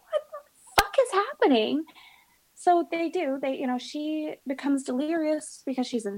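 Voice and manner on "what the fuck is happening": high, exasperated outburst; retold outburst to the doctors; exasperated disbelief. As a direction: at her wits' end, voice shooting up. Shocked at more surgery news.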